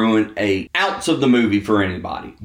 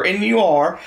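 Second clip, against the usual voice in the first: normal talking voice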